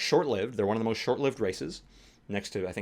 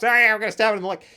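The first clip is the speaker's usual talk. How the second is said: silly halfling voice; 11 semitones higher, 9 dB louder, much faster than usual. Retold or imitated. Quoting a silly halfling player.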